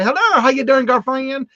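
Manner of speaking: loud, high call across the street; Madea-style call. Retold or imitated. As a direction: call out half a block away like Madea. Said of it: "they think it's Madea".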